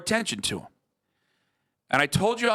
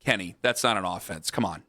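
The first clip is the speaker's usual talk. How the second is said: lightly imitating Kevin; 7 semitones lower than usual. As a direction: brush it off the way Kevin did. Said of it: "was just like".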